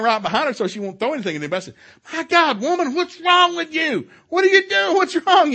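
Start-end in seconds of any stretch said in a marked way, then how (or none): 2.1-5.5 high, loud exasperated shouting; retold exasperated yell. Preacher retelling how he confronted a flailing woman, exasperated yell. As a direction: holler it in bewildered alarm, hammed up for the retelling. Retelling yelling at wife in fit.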